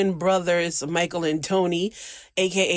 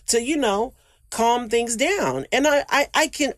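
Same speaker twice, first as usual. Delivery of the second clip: usual speaking voice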